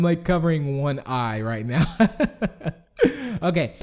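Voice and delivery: ordinary talk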